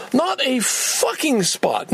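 slow, high, stretched-out frustration; exasperated. Real, not acted. Complaining to the camera about parking, exasperated. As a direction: lean hard on not, disbelieving.

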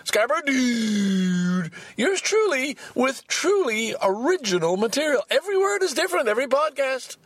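very high, theatrical announcer call; over-the-top podcast outro shout-out; hammed-up sign-off. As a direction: sing out the name long, then proclaim the tagline. Performed podcast sign-off tagline.

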